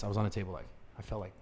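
very soft, low, barely voiced; overwhelmed murmur. Tripping man slumped at burger table, overwhelmed murmur. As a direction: shrink right down into a near-silent mumble.

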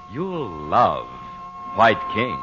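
normal talking voice

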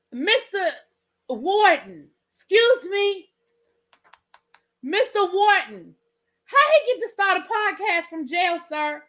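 high-pitched, incredulous calling out; mock indignant appeal. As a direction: demand answers from an imagined warden. Mock appeal to prison warden.

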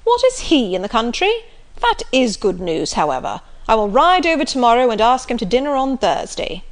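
loud, bluff country squire; jovial loud old gentleman; hearty Sir John. Acted. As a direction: boom it out like a jolly squire.

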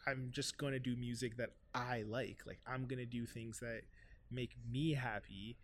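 usual speaking voice

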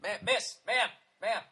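hammed-up gentleman presenting a celebrity; mock polite introducer. Comic to women walking by, introducing his friend, mock formal announcer. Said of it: "I'd be like".

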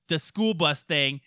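normal talking voice

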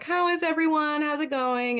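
high, over-casual check-in; feigned casualness. Retold or imitated. Worried mom phoning the governess, high feigned casualness. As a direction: act breezy to cover the worry. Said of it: "doesn't wanna seem like she's freaking out".

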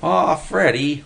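slow high whine on Aw, Freddy; a boy's complaining whine; whining. A narrator's reading. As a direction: draw out a pleading whine. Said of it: "Willie whined".

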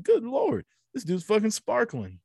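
high, amazed gasp of disbelief; amazed exclamation. Retold or imitated. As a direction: act stunned by the sparkle. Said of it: "and I was like".

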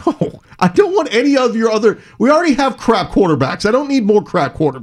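fed up and dismissive; exasperated. Real, not acted. Exasperated refusal about quarterbacks.

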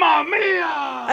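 very high, drawn-out shout; stretched, high-pitched imitation of the announcer; announcer catchphrase impression. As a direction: cry it out like an excited announcer. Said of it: "He says".

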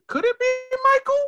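very high, worked-up wondering; excited suspense. Retold or imitated. Viewer wondering if it's Michael, high and excited. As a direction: build it high toward a sudden hit.

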